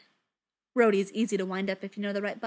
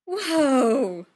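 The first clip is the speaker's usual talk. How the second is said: surprised; 3 semitones higher, 8 dB louder than usual. Acted. Tony startled by Jarvis.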